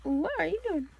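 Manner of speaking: a hint of a puzzled little cat; voicing the kitty cat. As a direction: play the cat asking what's going on. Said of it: "Oh, little kitty cat. It's like".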